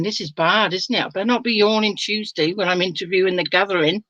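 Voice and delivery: ordinary talk, nothing marked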